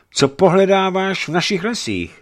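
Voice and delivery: put-on loud, high, stern demand of an old man. A narrator's reading. Old man sternly questioning the stranger in his woods. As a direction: challenge the intruder sternly. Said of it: "zeptal se a přísně".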